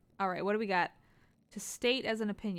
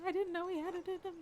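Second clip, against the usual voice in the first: surprised; 8 semitones higher, 4 dB quieter than usual. Real, not acted. Surprised at a character.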